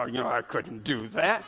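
low, soft mock-timid excuse; mocking the shy husbands in the pews, low mumble. A lightly voiced sheepish dodge. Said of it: "You guys are".

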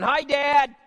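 high, loud greeting shout; kid hollering. Retold or imitated. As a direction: holler it like an excited kid at the door. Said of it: "before some kid is hollering".

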